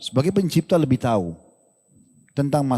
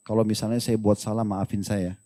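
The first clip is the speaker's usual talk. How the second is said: soft, low-pitched apology from a wife; lemah lembut; 6 semitones lower, 5 dB quieter than usual. Acted. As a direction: apologise gently, dropping pitch and volume. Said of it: "Makanya dengan lemah lembut". (minta maaf dengan suara lembut dan rendah)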